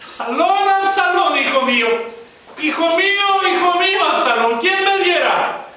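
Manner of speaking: high, loud anguished shouting of a son's name; grieving cry out. Acted. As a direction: cry out for a dead son, voice straining high. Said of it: "Y empieza a gritar".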